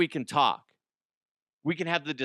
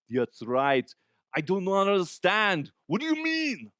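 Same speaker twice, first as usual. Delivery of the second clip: rising to a much higher, bad Arnold voice; a botched Arnold impression; 6 semitones higher, 4 dB louder than usual. Shown as a demonstration. Comedian demos the Arnold voice that gets him flagged. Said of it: "See, that was really bad".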